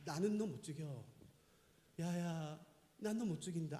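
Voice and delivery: low, soft voice of God; a quiet, gently refusing word; God relenting. Acted. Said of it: "그리고 이렇게 말씀하시는 거죠".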